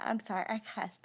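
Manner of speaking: ordinary talk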